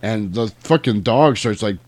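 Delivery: usual speaking voice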